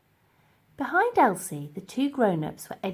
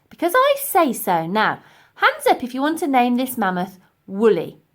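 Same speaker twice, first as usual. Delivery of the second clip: higher, loud commanding; bossy Elsie; 4 semitones higher, 9 dB louder than usual. Acted. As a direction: take charge of the vote. Elsie takes charge.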